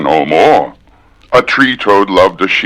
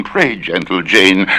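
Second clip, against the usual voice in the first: ordinary delivery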